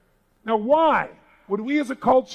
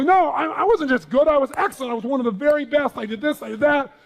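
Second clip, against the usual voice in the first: indignant protest; 6 semitones higher than usual. Retold or imitated.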